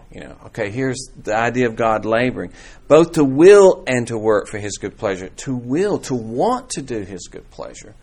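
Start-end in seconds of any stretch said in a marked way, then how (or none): 2.9-6.0 louder, with "will" stressed; the verse read louder with "will" stressed. Shown as a demonstration. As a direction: lean on "will" in "to will". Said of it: "emphasize a different word each time".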